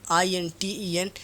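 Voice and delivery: letters spelled out slowly one by one; slow, deliberate spelling for students. Shown as a demonstration. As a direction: spell each letter slowly and clearly, like dictation. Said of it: "intentional questions".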